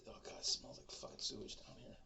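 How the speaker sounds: hushed disgusted aside; whisper. Retold or imitated. He complains about the sewage smell, a whispered aside.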